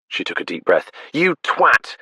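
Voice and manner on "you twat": high, loud shout at the trees. Acted. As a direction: yell it out to the world. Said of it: "she shouted at the trees".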